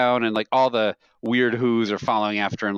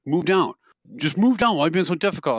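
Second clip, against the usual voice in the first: retold badgering; 6 semitones higher than usual. Coworker badgering people in theater.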